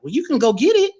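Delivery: high teasing mom voice; imitating his mother. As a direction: toss off mom's reply, pitched high. Retelling mother's quick reply.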